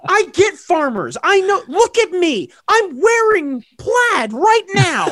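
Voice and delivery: excited. Acted. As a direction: play up being a farmer. Mock bragging about farmers.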